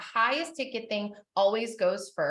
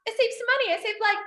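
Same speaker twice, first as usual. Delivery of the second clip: much higher, excited self-congratulation; giddy shopper; 8 semitones higher than usual. Retold or imitated. Marketer mocking her own joy at discounts, giddy and high. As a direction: gush like a thrilled bargain hunter. Mock happy shopper quote.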